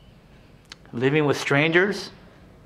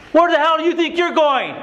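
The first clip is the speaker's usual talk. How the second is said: a much higher, sharp challenge from the father; angry father; 10 semitones higher than usual. Retold or imitated. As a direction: throw the question at him like a furious dad. Father confronting son leaving home.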